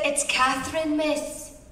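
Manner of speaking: a lightly voiced Orkney maid, timid and polite; Orkney Scottish maid. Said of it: "Catherine the Maid's from the Orkney Islands".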